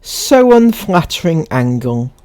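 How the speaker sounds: measured, emphatic reading voice; read-aloud. Acted. Reading someone's post to viewers, read-aloud.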